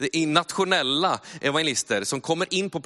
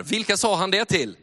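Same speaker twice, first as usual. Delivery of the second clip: ordinary delivery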